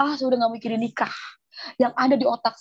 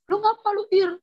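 much higher, sharp mock sister; imitating older sister; 9 semitones higher than usual. Sister demanding what happened, in a high imitation. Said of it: "kata mbak saya".

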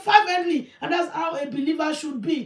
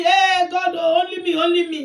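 loud, insistent me-me plea; mock a believer pleading only for himself; mock selfish prayer; 3 semitones higher, 6 dB louder than usual. Believer praying day and night for himself, loudly mocked.

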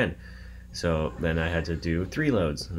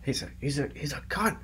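imitating the dad; 9 semitones higher, 4 dB quieter than usual. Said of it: "Yeah, I just, he's".

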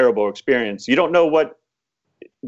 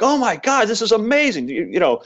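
much higher, gushing with amazement; amazed neighbor; 12 semitones higher than usual. Retold or imitated. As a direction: play the dazzled neighbor who can't believe the luck. Neighbor amazed at returned horses.

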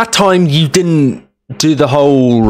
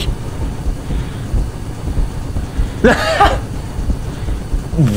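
airy giggle of relief; breathless high laugh; 6 dB quieter than usual. Real, not acted. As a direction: laugh out the tension. Escaped the monster.

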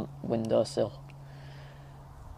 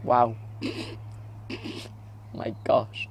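ordinary talk

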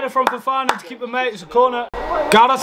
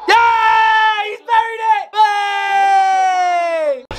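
goal celebration; 6 semitones higher, much slower than usual. Real, not acted. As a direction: let it burst out as the ball hits the net. Vardy scores, fan erupts.

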